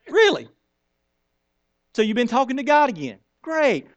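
high, sarcastic "really?"; sarcastic wife. Retold or imitated. Sarai mocks the new name.

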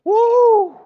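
high, loud whoop; excited woo; mock delighted woo. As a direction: whoop with joy. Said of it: "you go".

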